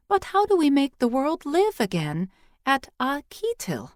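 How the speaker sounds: put-on high, eager child asking; a curious little girl, pitched high; young girl's voice. A narrator's reading. Girl questioning the old priestess, high and eager.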